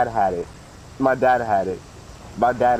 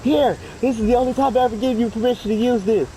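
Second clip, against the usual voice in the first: high solemn mom; high-pitched mock serious mom; hammed-up mom handing over; 13 semitones higher than usual. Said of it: "She was like".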